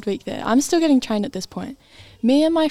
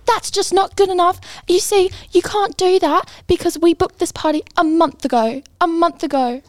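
imitating an irate customer, higher and a bit louder; mock telling-off, pitched up; retold angry screaming; 6 semitones higher, 3 dB louder than usual. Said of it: "she starts screaming at me".

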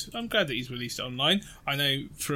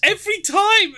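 high exclaim; 7 dB louder than usual. Real, not acted. Mock exasperated exclaim.

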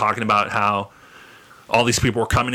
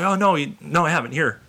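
higher, innocent, a touch coy; hint of a gentle Fred; lightly voiced Fred Rogers; 6 semitones higher than usual.

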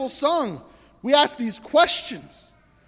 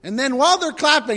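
usual speaking voice

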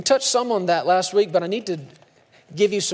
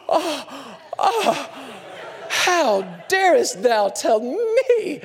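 mock offended gasp; 6 semitones higher, much slower than usual. Mock offended churchgoer, gasping.

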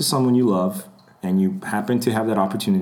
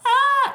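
very high squeal at ordinary volume; mock fan scream. Fan overwhelmed on meeting celebrity, mock screaming. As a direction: squeal like a starstruck fan, mockingly.

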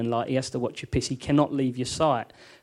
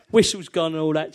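usual speaking voice

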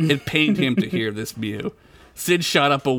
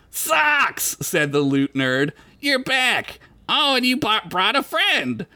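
higher nerdy bard voice; 9 semitones higher, slower than usual. Acted. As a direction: play the lute nerd. Lute nerd character speaks.

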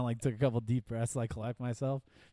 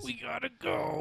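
high, strained mock urgency; hammed-up panic, high and strained; 13 semitones higher, slower than usual. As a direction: squeak it out like it's an emergency. Mock panicked exit at strip bar.

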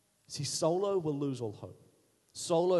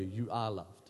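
a pastor quietly declaring hope over someone; tender reassurance; 7 semitones lower, 4 dB quieter than usual. Acted. Pastor to his congregation, speaking hope softly over them. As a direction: lower and softer, spoken gently over the listener. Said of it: "Let me start speaking it over you".

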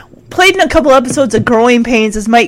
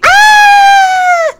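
put-on crowd of girls screaming. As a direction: scream like swooning fangirls. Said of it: "hear the girls go crazy".

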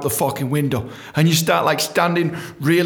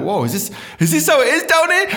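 surprised; 12 semitones higher, 4 dB louder than usual. Retold or imitated. Surprised in nightclub.

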